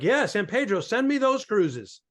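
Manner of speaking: high, drawn-out mock eagerness; eager acceptance. Comic accepting cruise dates from San Pedro, eager acceptance. Retold eager yes to booking.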